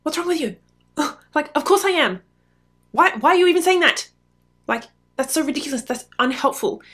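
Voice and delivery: high, loud, fast incredulous outburst; venting spouse rejecting a calm reflection, exasperated; exasperated retort. Retold or imitated. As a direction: snap back at a spouse who is being patronising. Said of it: "You'd be like".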